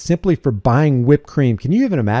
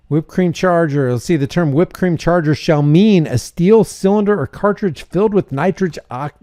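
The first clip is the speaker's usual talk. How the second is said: ordinary delivery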